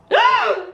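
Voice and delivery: high, sharp gasp of shock; sudden high intake of breath. Retold or imitated. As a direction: gasp as if caught out. Retelling door flung open.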